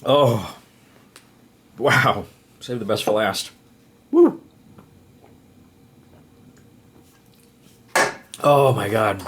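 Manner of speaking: loud, high triumphant whoops; excited relief. Real, not acted. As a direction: cheer it out like you just won. Relief after finishing eating challenge.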